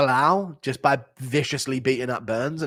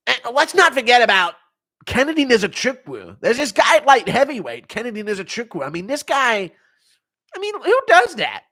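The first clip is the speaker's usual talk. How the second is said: high, loud hyped-up Joe Rogan; excited Joe Rogan impression, much higher; 10 semitones higher, 7 dB louder than usual. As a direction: gush like an amazed podcast host. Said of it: "Impression of Joe Rogan".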